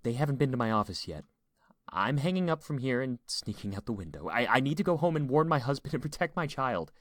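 frightened dispatcher resolved to flee; urgent distress. A narrator's reading. Frightened dispatcher plans her escape.

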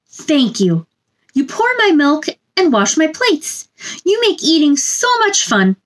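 ordinary delivery, nothing marked

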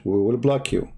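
usual speaking voice

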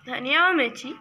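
normal talking voice

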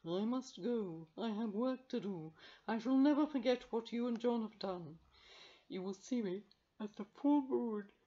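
part with dignity; solemn cat farewell. Acted. Carbonel saying good-bye to the children, solemn.

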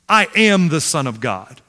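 normal talking voice

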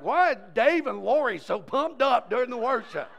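exaggerated incredulous query; puzzled churchgoers. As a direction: mimic a baffled congregation. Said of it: "Everybody's like".

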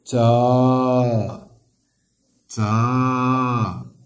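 تا held with a long drawn vowel; long vowel syllable. Shown as a demonstration. Teacher showing pupils the long vowel, drawn-out تا. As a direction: stretch the alif in تا. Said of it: "ألف ديال المد".

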